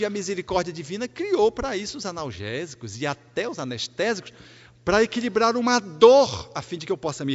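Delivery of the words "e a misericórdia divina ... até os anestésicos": ordinary talk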